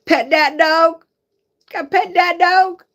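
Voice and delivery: high, loud delighted cheer; excited cheer. Real, not acted. Cheering the dog on to pet, high and loud. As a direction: egg the dog on, thrilled and loud.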